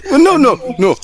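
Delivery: high, hurried string of protests; flustered denial. Acted. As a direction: try to hush a wailing accuser. Player hastily denies child's accusation.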